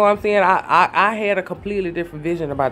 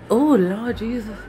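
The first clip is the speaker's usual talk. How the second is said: exasperated; 6 semitones higher than usual. Real, not acted. Exasperated aside.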